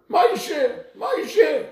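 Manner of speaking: high, loud cry across the harbor; a mother's loud calling cry. Retold or imitated. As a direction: shout his name from far down the dock.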